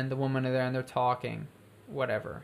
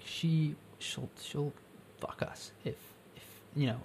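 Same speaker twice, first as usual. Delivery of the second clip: hushed; 9 dB quieter than usual. Retold or imitated. Conspiratorial hushed offer from Cody.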